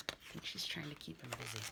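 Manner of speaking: plain reading voice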